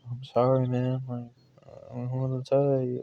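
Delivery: soft, sheepish mumble; retold apology with head down, hushed; ashamed apology. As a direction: quietly own up, embarrassed. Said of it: "I'm ashamed".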